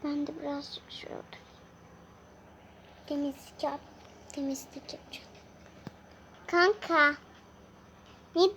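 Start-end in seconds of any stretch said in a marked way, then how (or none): 0.0-5.3 fast, hushed whisper. Acted. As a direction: whisper it so nobody hears. Sneaks to sit down. (hızlı fısıltı)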